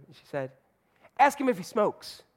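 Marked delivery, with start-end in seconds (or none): 1.2-2.2 put-on little girl voice. Girl voiced in retold story.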